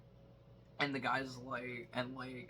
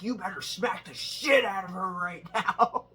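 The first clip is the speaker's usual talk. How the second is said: mock tough guy; 6 semitones higher, 6 dB louder than usual. Guy yelling, retold.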